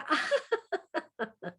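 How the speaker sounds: high, forced customer-service laugh; a put-on laugh with nothing funny behind it; phony fake laugh. Shown as a demonstration. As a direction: fake a polite laugh for the public. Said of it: "trained to do the fake laugh".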